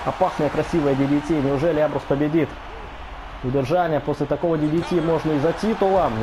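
fast, high excited play-by-play; wrestling commentator. Real, not acted. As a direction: call the big move live. (возбуждённый комментатор реслинга)

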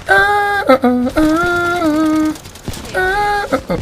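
panicking gamer. Real, not acted. Nearly dying in game.

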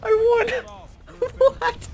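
high, loud laughing triumph; excited shout. Real, not acted. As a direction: whoop with disbelieving joy. Escaping alive, elated.

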